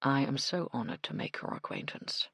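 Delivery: soft, smooth gentleman's voice; exquisite voice. Acted. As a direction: speak softly, honeyed and smooth. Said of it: "in an exquisite voice".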